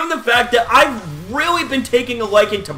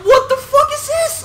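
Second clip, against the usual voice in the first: much higher, loud shocked shout; shocked yell; 3 dB louder than usual. Retold or imitated. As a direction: scream your disbelief at the result. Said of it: "I'm like".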